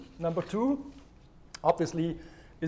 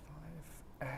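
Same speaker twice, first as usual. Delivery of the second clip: whispered count; 18 dB quieter than usual. Shown as a demonstration. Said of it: "counting breaths in a whisper".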